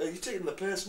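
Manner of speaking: high, suspicious Scottish question; Scottish accent. Retold or imitated. Scot suspecting he's being mimicked, suspicious Scottish accent. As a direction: challenge them in an imitated Scottish accent. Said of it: "Meet somebody who's Scottish".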